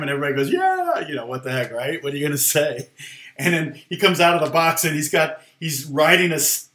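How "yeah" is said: high, eager mock yeah from the crowd; crowd's eager yeah. Crowd humoring the comic, high eager yeah. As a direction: call it out like a whole audience humoring him.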